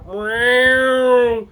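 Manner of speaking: loud, high, drawn-out meow; practised animal noise, full out; cat meow imitation. Shown as a demonstration. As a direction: yowl like a cat. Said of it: "I practice my sounds".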